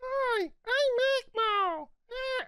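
very high, drawn-out squeaky puppet voice; strained high puppet imitation; Magmo impression. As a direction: attempt a slow falsetto Elmo-style voice. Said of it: "take a crack at the Magmo voice".

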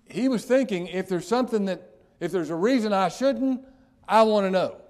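loud, high urgent voicing; preaching at full force. Preacher voicing the eunuch's urgent wish, loud and high. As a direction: press the question with urgency.

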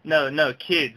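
ordinary talk

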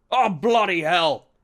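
loud, drawn-out curse; pained cry. A narrator's reading. Inspector falls through the door, crying out a curse. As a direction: cry out slowly and high. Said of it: "he cried".

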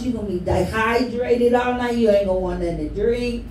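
normal talking voice

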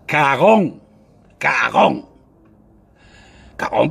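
ordinary delivery

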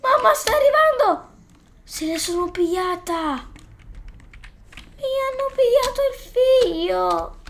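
frantic mother. Acted. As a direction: wail like a mother whose son was taken. Voicing a panicked game mother.

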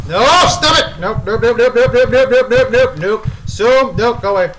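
loud, high rapid-fire panic; panicked refusal. Real, not acted. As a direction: spit out repeated refusals while fleeing. Fleeing game pursuer.

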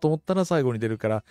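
ordinary talk, nothing marked

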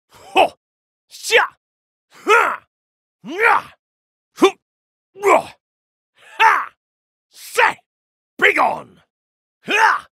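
high, loud strike cries; short fierce fighting shouts; combat yells. Acted. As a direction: bark out each attack. Combat grunts.

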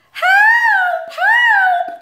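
very high, loud wailing cry for help; wailing baby kangaroo. Acted. Baby kangaroo wailing underground, very high and loud. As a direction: cry out like a lost little joey. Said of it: "What is that wailing sound?".

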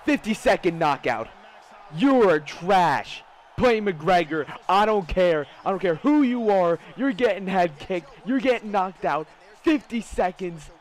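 loud, emphatic trash talk; gloating shout. Real, not acted. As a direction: crow over a beaten rival at full volume. Gloating after fifty-second knockout.

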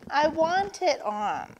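put-on high whine of a wanting child; whining. A narrator's reading. Turtle begging Vulture for the bracelet, whining. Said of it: "whined Turtle".

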